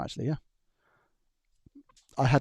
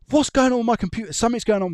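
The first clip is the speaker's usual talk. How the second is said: higher, louder stressed outburst; frustrated coworker; 10 semitones higher, 5 dB louder than usual. Retold or imitated. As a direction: play the panicking coworker yelling at his PC. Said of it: "he's banging on tables".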